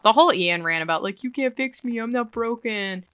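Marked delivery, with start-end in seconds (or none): 1.2-3.0 higher, soft mock plaint; eye-rolling mockery. Fan rolling eyes at Ian's rant, soft mocking whine. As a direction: parrot a self-pitying line, soft and raised. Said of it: "I kinda have to roll my eyes".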